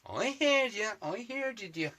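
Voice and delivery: high, put-on squeaky voice; mock reply. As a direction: answer the heckler in a silly high voice. Answering imagined shouting viewers.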